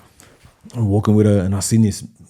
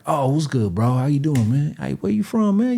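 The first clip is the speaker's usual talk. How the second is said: higher-pitched, soft imitation of a friendly guy; mock friendly greeting, pitched up and soft; imitated stranger; 4 semitones higher, 4 dB quieter than usual. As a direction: lightly voice a stranger's eager greeting. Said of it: "He was like, uh, uh, like this".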